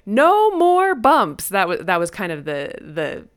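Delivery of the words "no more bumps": drawn out very slowly, higher and louder; mock-stern mom's slow singsong; mom's playful catchphrase. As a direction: stretch every word like a teasing singsong. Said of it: "And then she'd say".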